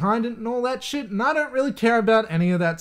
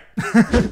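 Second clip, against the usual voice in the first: short, louder burst of laughter; bright laugh; 5 semitones higher, 5 dB louder than usual. Real, not acted. Laughing to viewers about the fight-to-the-death movie. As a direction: let a laugh pop out.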